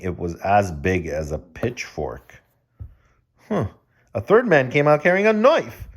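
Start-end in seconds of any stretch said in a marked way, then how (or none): none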